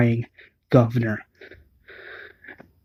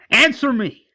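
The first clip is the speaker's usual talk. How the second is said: much higher mock shout of Answer me; retold angry demand; 11 semitones higher than usual. Angry confrontation with the governor.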